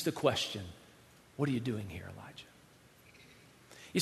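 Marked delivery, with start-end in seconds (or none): 1.4-2.4 low, much softer, almost a whisper; still small voice. Acted. God repeats the question to Elijah, still small voice. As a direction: let it fade to a hush. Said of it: "in a still, small voice".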